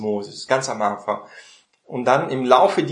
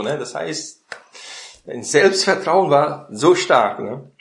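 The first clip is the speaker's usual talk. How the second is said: usual speaking voice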